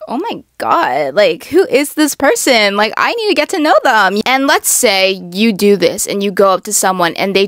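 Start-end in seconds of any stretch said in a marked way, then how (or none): 0.1-4.2 impressed gush. Retold or imitated. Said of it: "people are gonna be like".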